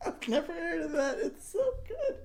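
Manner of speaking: high, squeaky words through helpless laughter; soft, laughing squeal; real laughter. Real, not acted. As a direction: barely get it out while cracking up. Cracking up at cinnamon joke.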